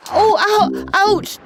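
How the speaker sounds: very high squealing ouch; piercing high squeal of pain. A narrator's reading. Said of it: "squealed Timmy".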